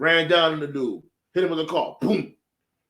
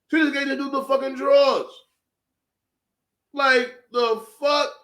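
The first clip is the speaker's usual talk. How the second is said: high-pitched disbelief; incredulous outrage; 9 semitones higher than usual. Real, not acted. Outraged reaction to betrayal in story.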